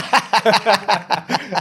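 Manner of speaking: helpless high laugh; loud laugh. Real, not acted. Laughing at own cluelessness.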